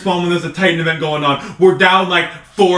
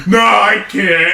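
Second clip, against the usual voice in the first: mock dramatic voice; much slower than usual. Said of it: "And he was like".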